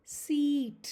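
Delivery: सीट with a long held ee; long ee sound. Shown as a demonstration. Teacher demonstrating the long E to learners, drawn out. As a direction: stretch the ee in सीट. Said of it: "long ई का sound कैसे होता है".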